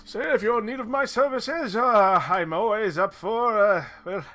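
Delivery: high, loud put-on guide voice; Deckard Cain. Game master as local guide offering services, high theatrical voice. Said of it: "This is the Deckard Cain of Chult".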